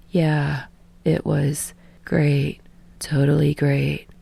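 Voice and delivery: dead tired but forcing a polite answer; exhausted politeness. Acted. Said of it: "trying to appear less than dead on her feet".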